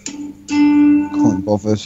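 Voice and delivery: ordinary delivery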